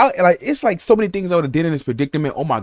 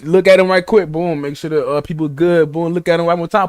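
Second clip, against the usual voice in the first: ordinary delivery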